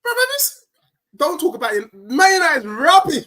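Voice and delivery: high, loud excited rant; excited outburst. Real, not acted. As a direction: burst out in mock outrage at the rival. Heated football banter outburst.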